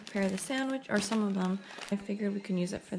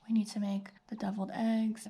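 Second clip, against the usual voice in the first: hushed unvoiced whisper. Real, not acted. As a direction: breathe it without voice. Whispered versus spoken list.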